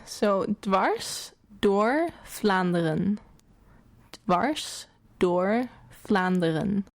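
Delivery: slow "dwars door Vlaanderen", "Vlaanderen" with an f sound; careful Dutch "dwars door Vlaanderen", rolled r; Dutch pronunciation. Shown as a demonstration. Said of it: "That's not bad".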